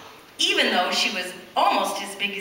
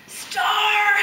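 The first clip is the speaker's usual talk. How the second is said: shout; 10 semitones higher than usual. A narrator's reading. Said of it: "shouted Davy".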